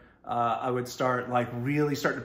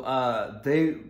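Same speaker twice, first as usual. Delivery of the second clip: ordinary talk, nothing marked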